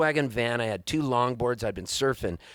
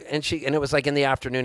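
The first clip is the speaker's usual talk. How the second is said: ordinary talk, nothing marked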